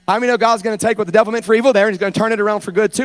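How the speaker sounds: ordinary talk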